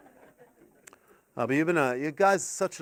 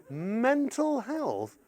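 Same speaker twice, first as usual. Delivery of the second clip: slow, softer, more disbelieving echo; incredulous repeat; 5 semitones higher, 7 dB quieter, much slower than usual. Retold or imitated. Parent echoing the kids in slow disbelief. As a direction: drag it out, incredulous. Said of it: "The kids are going".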